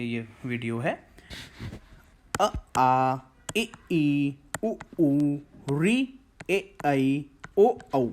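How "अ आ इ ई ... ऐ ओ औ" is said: each vowel said clearly, short ones brief, long ones held; vowel chart recited. Shown as a demonstration. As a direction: model the eleven vowels for the class. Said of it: "जब मैं अ बोल रहा हूँ, इसमें थोड़ा सा कम समय". (स्वरों का स्पष्ट उच्चारण, ह्रस्व छोटे और दीर्घ लंबे)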